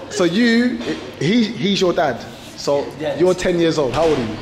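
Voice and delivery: ordinary talk, nothing marked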